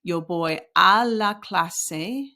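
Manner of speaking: "Yo voy a la clase" drawn out slowly, each word set apart; slow model sentence. Shown as a demonstration. Teacher modelling a sentence for students, slow and deliberate. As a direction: spell it out slowly for learners. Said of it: "sometimes you say".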